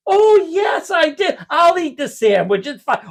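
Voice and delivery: high-pitched, over-agreeable dad impression; hammed-up caving tough guy; mock badass backing down. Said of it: "Oh, Mister Badass walking to the counter".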